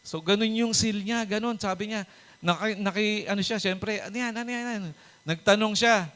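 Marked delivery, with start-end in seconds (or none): 4.0-5.0 high, quick, hushed questioning; eager to know what is going on; curious David. Acted. (mataas at mahinang usisa)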